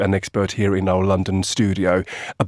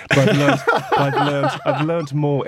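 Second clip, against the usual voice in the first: higher, laughing through the words; 6 semitones higher than usual. Real, not acted. Guest defending his cricket knowledge to the teasing host, laughing. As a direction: break into laughter while insisting.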